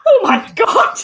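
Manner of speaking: high, loud burst of shocked delight; surprised gasp. Real, not acted. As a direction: blurt it out in disbelief. Absurd Danny Dyer casting idea.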